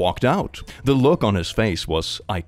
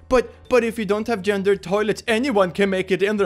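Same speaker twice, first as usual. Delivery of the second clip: mocking objector; 9 semitones higher than usual. Mocking bathroom argument.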